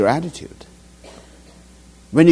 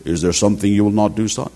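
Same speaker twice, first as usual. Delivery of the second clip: big Kerala father; 7 semitones lower, faster than usual. Retold or imitated. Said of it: "This is how he will speak".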